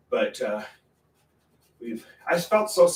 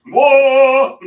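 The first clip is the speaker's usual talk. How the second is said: long, high, very loud wail; a sustained full-blast "whoa"; siren-like scream; 13 semitones higher, 16 dB louder than usual. Acted. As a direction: scream out like an air raid siren. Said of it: "scream out".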